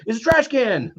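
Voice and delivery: high, incredulous "come on, it's a trash can"; exasperated retort. Retold or imitated. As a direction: say it like the answer is obvious.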